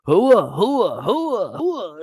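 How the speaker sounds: loud, high barked army chant, repeated; barked hooah. Retold or imitated. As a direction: bark it like an Army drill crowd. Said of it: "all you hear is".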